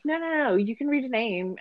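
high, quick imitation of insisting; retold insistence. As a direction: wave off the objection in an imitated wife's voice. Retold wife insisting to husband.

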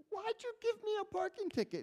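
high, fast, indignant challenge; indignant demand. Retold or imitated. Confronting the warden over a ticket, mock indignant. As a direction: demand it like you've been wronged.

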